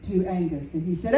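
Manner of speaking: usual speaking voice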